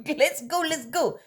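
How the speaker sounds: high, pushy "let's go" imitation; playful impression of an eager go-getter; mock drill sergeant. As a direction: chant it like a coach hurrying her on. Voicing her drill-sergeant drive.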